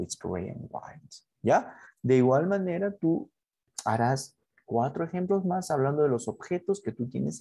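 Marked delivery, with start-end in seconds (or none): 0.0-1.2 much softer, lightly tossed off; teacher's quiet recap; soft quick repeat. Shown as a demonstration. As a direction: mumble it again in passing. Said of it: "Es plomo y blanco".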